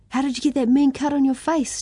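put-on high, eager little boy's question; bursting to know about the cut; excited kid. A narrator's reading. Little brother asks Yosefa about his cut, excited. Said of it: "Timothy asked excitedly".

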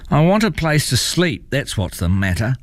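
grumpy complaint, starting high; complaining troll. A narrator's reading. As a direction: complain high, then drop at the end.

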